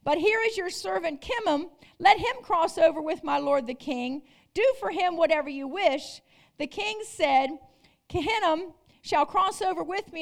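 ordinary delivery

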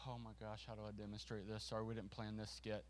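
low, soft aside; quiet aside. Real, not acted. Speaker apologising about an unplanned skit, in a quiet aside. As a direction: drop the voice, as if off-stage.

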